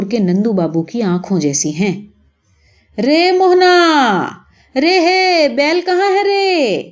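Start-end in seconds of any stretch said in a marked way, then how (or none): 3.0-6.8 high, slow, drawn-out call from afar; mother calling out. Acted. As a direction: call your son home across the fields. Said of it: "تمہاری ماں پکار رہی ہے". (ماں کا دور سے اونچی لمبی آواز میں پکارنا)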